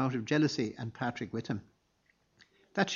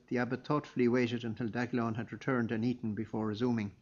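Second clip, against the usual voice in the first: ordinary delivery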